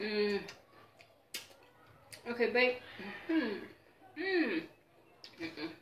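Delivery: ordinary delivery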